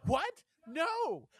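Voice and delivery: high, loud acted protest; startled denial. Coworkers answering an accusing customer, startled denial. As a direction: leap up in pitch, mock innocence. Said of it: "And we were like".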